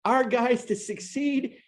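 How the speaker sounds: pleading that we want our guys to win; real emotion. Real, not acted. Host to rider, voice rising with real emotion. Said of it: "I'm getting a little emotional here".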